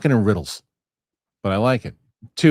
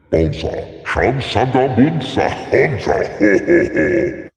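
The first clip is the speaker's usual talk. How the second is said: booming alien gibberish, louder; mock Huttese; 6 dB louder, slower than usual. Host mimicking a Hutt speaking alien, booming. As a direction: rumble made-up Hutt words.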